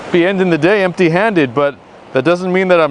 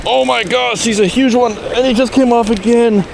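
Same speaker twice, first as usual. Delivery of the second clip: high, urgent cry of loss; excited dismay; 8 semitones higher than usual. Real, not acted. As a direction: shout it out as the fish escapes. Huge fish lost again.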